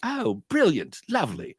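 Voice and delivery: mock British enthusiasm, high-pitched start; British accent. Quoting conference-goers in London, put-on British accent. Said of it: "But you'd have that accent".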